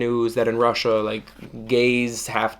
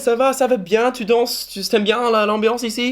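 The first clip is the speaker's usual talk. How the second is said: chirpy flirty French; 8 semitones higher than usual. Retold or imitated. Flirty opener to man in club.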